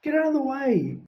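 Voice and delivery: annoyed schoolboy snap; snapping. Acted. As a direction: shove the words at the poltergeist. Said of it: "snapped Ron".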